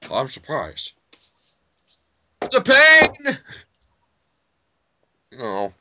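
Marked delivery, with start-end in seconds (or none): 2.4-3.4 high, loud hammed-up cry; mock agony. Gamer hamming up pain as his character is hit. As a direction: wail as if mortally wounded.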